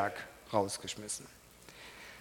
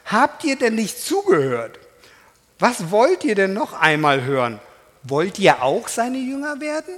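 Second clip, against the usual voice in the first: loud, exasperated retort; indignant calling out; 4 semitones higher, 7 dB louder than usual. Acted. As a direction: throw it back at the Pharisees. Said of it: "rief der Mann". (lauter, empörter Zuruf an die Pharisäer)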